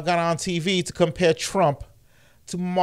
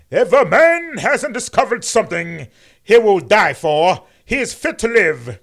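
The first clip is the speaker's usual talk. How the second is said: loud, higher oratorical King voice; booming mock King sermon; MLK impression; 5 semitones higher, 7 dB louder than usual. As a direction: preach it with swelling pulpit rhythm. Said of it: "Excuse me, I said that wrong".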